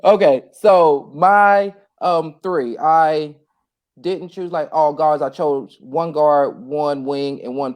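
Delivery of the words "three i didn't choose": ordinary talk, nothing marked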